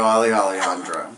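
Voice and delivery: usual speaking voice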